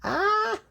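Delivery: surprised yelp. Real, not acted. Reacting to blackhead.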